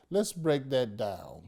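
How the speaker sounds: ordinary delivery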